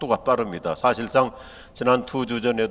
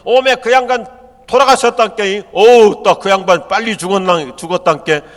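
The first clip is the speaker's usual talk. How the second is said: loud, high Jeolla-style wailing over a death; hammed-up southern dialect gossip; imitating Jeolla folk, higher and louder; 8 semitones higher, 10 dB louder than usual. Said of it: "예를 들어서 뭐 전라도건 경상도건".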